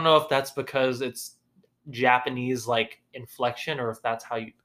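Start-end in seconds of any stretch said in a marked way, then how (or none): none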